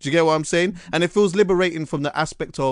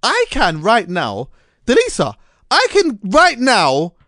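emphatic; 5 semitones higher, 4 dB louder than usual. Real, not acted. Passionate declaration.

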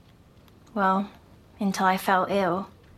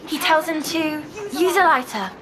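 understated yelled punchline, pitched up; Jester's yell; 7 semitones higher than usual. A narrator's reading. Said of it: "Jester yelled out".

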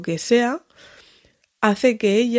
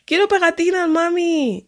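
put-on high little-girl voice asking eagerly; toddler voice; 9 semitones higher than usual. Toddler asking her mother for stickers, high and eager. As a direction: sound like an excited two-year-old.

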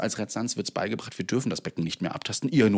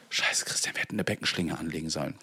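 whisper; 4 semitones lower, 6 dB quieter than usual. Retold or imitated. Colleagues' panicked whispered quote.

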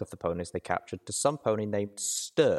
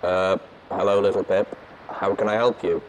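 louder robotic speaker voice; tinny artificial voice; 6 dB louder than usual. A narrator's reading. Watcher answering through the Sprite Bot, tinny artificial voice. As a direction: speak as a tinny machine, loud. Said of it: "The tinny, artificial voice".

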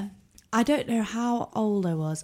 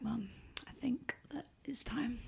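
hushed, secretive whisper; 16 dB quieter than usual. Retold or imitated. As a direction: breathe it like a big secret. Announcing first period to mum.

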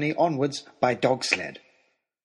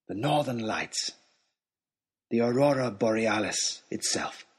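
awed hush; 5 semitones lower, 4 dB quieter than usual. Acted. Awed sight of the aurora.

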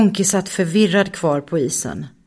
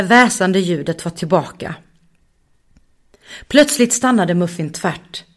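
plain reading voice

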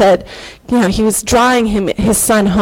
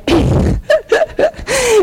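a choked, tearful little laugh; teary laugh. Real, not acted. Wife sharing her testimony on stage, laughing through tears.

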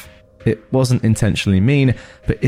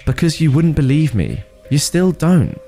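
hurt accusation; 8 semitones higher than usual. Acted. Arguing with partner over disbelief.

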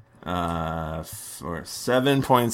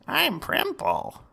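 lightly voiced high goblin; character voice. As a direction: pop up high as the monster. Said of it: "My voice keeps changing".

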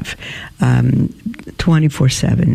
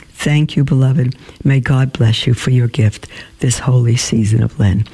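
usual speaking voice

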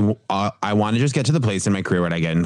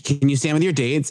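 bossy mom voice; 7 semitones higher than usual. Retold or imitated. Prom mom giving orders.

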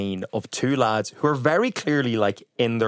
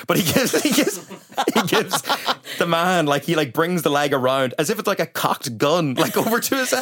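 high, giggly telling, breaking into laughter; laughing; 6 semitones higher than usual. Real, not acted. Cohost describing film kick to the man, laughing. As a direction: keep restarting as the laugh takes over.

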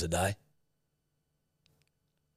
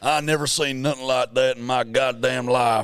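put-on Southern US accent. Host feeds an accent to mimic, Southern US accent. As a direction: play a broad American Southerner. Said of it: "Full on mimic everything you do".

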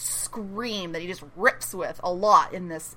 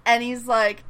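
ordinary talk, nothing marked

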